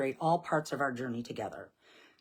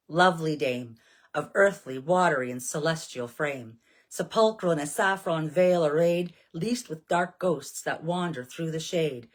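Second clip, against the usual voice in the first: solemn hymn recitation; 5 dB louder than usual. Acted. Priestess reciting the Orphic hymn to her viewers, solemn invocation. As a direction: call on the goddess as if at an altar.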